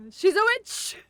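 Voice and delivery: high, loud accusing shout. Pretend. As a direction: cry out like a villager. Accusing the magician.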